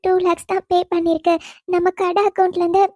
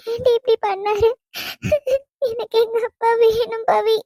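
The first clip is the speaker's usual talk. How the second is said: higher-pitched, sobbing lament; crying; 4 semitones higher than usual. Acted. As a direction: wail in anguish to a confidante, gasping between phrases. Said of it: "இப்படி அழுதுட்டே இருந்தா எப்படிடி". (அழுது புலம்பி)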